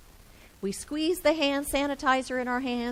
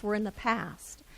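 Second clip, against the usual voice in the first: usual speaking voice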